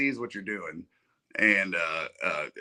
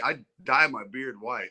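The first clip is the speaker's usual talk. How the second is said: normal talking voice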